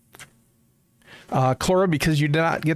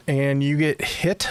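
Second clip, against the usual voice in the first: usual speaking voice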